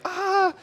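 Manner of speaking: a sharp high gasp of dread; nervous high little gasp; anxious gasp. Retold or imitated. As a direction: gasp like a child waiting for the belt. Dreading the coming spanking.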